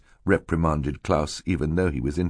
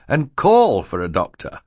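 higher, louder suggestion; officious banker; 6 semitones higher, 5 dB louder than usual. Acted. Mister Poe proposes phoning a doctor, officious. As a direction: finish the proposal emphatically.

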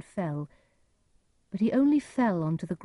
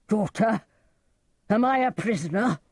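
loud, raised questions; indignant cry; 4 semitones higher, 5 dB louder than usual. A narrator's reading. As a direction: cry out in alarm and disbelief. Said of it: "cried Lear".

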